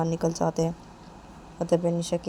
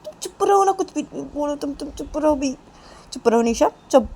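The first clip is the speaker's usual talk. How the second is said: high-pitched, urgent telling-off; exasperated hushing; 8 semitones higher, 7 dB louder than usual. Acted. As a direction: shut them up in exasperation. Manisha tries to silence Nisha.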